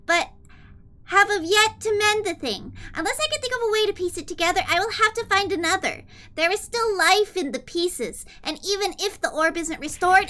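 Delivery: put-on high cutesy anime-girl read; super kawaii desu voice. Streamer reading a villain's note to chat in kawaii voice. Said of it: "a super kawaii desu voice".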